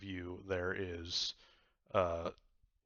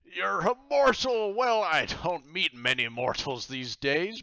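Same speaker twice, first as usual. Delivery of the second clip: loud, high pirate exclamation, then slower; astonished pirate god; 10 semitones higher, 12 dB louder than usual. Acted. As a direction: boom out surprise at a mortal. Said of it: "stands up and says".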